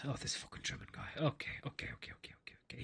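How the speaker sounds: whispered grumbling under the breath; exasperated whisper. Retold or imitated. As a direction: curse to yourself while giving up. Lady grumbles giving in.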